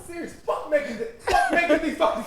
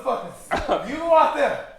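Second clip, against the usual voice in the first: ordinary delivery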